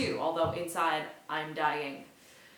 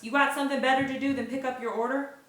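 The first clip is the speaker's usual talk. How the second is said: barking; 5 semitones higher, 5 dB louder, faster than usual. Acted. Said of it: "Braverman barks".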